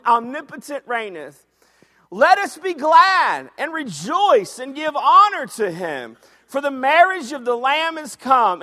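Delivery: high, loud, fired-up preaching; preaching at full force. Acted. Preacher urging the church to shout in heaven, fired up. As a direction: lift the whole room with it. Preacher reads scripture loudly.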